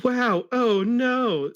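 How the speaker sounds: high, loud, slow hammed-up dismay; whole class gasping in mock fright; surprised and scared. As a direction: drag out a mock horrified wail. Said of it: "in a surprised and scared way".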